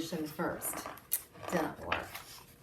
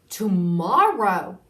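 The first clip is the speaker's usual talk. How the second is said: surprised tomorrow; 11 dB louder than usual. Acted. Groundhog shocked by question.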